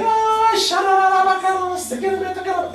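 high, hammed-up babble in tongues; imitating a lady babbling in tongues; mock speaking in tongues. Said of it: "And they crying".

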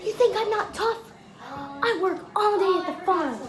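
high, indignant farmer voice; boasting farmer. Retold or imitated. Farmer insists to bouncer he is tough, high indignant brag. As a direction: puff up and brag about being tough.

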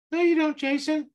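mock yourself in a tiny doubting voice; subconscious voice. Shown as a demonstration. Coach voicing his doubting subconscious, squeaky and scoffing. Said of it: "use my little funny voices".

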